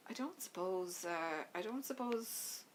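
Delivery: slow, halting restart of the same words; hesitant husband, slow and unsure, asking his wife a favour. Acted. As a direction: stall and start the request over. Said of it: "Eduardo felt uncharacteristically hesitant".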